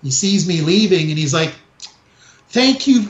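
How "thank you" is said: imitating a fake-polite high thank you; mock the store guard's phony politeness.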